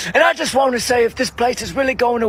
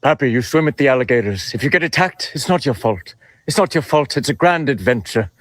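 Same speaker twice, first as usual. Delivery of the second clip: much lower, gentle comforting voice; Robin Williams impression; 9 semitones lower than usual. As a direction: sink the voice deep and soothe him like a mentor. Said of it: "I'm gonna do a little Robin impression".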